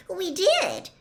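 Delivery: high-pitched female protest; high, pleading girlish voice; insistent girl. A narrator's reading. Girl insists to sceptical friend.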